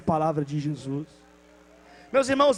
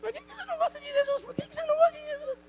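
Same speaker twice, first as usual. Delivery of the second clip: put-on much higher, quick child voice in his head; echoing child voice; 4 dB quieter, faster than usual. The girl's question echoing in the neighbor's head, high child voice. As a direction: let the girl's question haunt him. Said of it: "Aí ele foi trabalhar".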